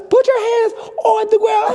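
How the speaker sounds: high mock command breaking into laughter; laughing cop imitation. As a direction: yell the order while cracking up. Officer voice while laughing.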